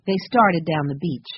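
ordinary delivery, nothing marked

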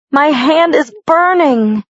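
high, slow pained cry; screamed. Acted. Bella screaming to Edward about her burning hand, high pained cry. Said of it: "I screamed".

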